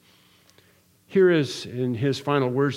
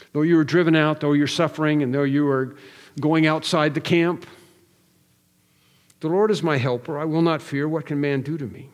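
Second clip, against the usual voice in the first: higher, louder, insistent 'no, never'; fervent preaching; 3 semitones higher, 4 dB louder than usual. Acted. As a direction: hammer the promise home to the congregation. Preaching at full force.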